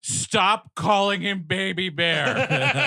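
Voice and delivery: mock-stern order. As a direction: spell it out slowly as an exasperated command. Acted stern order, stressed plea.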